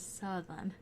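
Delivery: "southern" softly, with no R, "suth-un"; dropped R. Shown as a demonstration. Showing viewers how the R drops, "southern" as "suth-un". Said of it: "You drop the R's".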